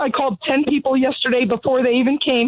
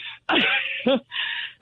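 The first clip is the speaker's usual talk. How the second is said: laugh; 7 dB quieter than usual. Real, not acted. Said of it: "Oh, sorry".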